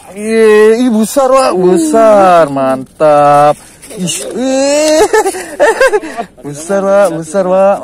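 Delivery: very loud, high whoop breaking into laughter; elated shouting. Real, not acted. Angler landing a big fish, elated and laughing. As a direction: shout in real delight, laughing midway.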